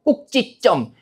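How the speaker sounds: 꼭지점 said as kkok-jjit-jjeom, tense jj sounds; demonstrated pronunciation. Shown as a demonstration. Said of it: "이게 찌로 발음되는 건".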